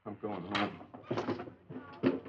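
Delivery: very slow, soft and hurt; dejected. Acted. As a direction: mumble it, crushed. Humiliated, leaving.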